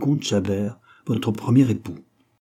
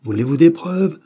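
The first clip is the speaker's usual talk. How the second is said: louder, higher, daring her; angry challenge; 6 semitones higher, 7 dB louder than usual. A narrator's reading. The colonel challenging his wife to deny him, angrily. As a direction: throw down the challenge.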